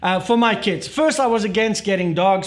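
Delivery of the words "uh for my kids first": ordinary delivery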